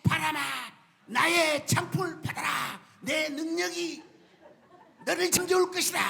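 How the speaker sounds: loud grand proclamation. Acted. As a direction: overblown superhero command. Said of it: "굳이 큰 소리로".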